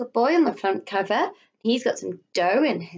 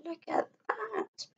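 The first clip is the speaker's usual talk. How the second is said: hushed whisper; 11 semitones higher, 11 dB quieter, slower than usual. Shown as a demonstration. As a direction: whisper it like a secret. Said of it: "whispered aside to listeners".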